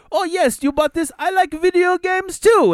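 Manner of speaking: high, put-on foreign agent voice; hammed-up customer service rep; call center agent voice. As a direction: mimic an overly chirpy phone agent. Imitating the agent.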